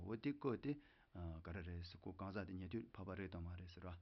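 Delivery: ordinary delivery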